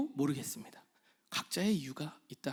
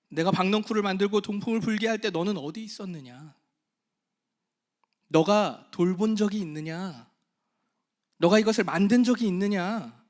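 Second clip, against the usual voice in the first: fervent sermon climax fading at the end; impassioned preaching; 2 semitones higher, 6 dB louder than usual. Acted. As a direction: preach it with full force, building.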